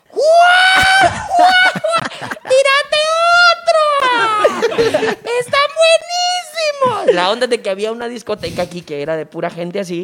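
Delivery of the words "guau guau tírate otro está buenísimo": gritos muy agudos y eufóricos del público; multitud eufórica. Retold or imitated. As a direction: grita como la multitud que pide más. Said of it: "comienza toda la multitud así". (ovación aguda de la multitud)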